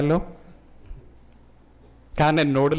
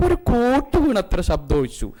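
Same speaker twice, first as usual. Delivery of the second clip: punchline; 7 semitones higher than usual. Retold or imitated. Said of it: "Nasaruddin".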